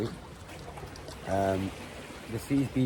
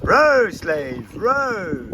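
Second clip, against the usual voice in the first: high, loud galley master yelling orders; mock slave-driver shout; 9 semitones higher, 5 dB louder, much slower than usual. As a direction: bellow it like a cruel galley master. Bossing rowers on a boat.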